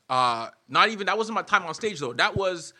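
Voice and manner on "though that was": ordinary talk, nothing marked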